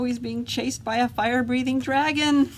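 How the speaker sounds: usual speaking voice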